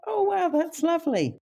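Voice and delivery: retold sweet delight for the patient; delighted reply. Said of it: "And I went".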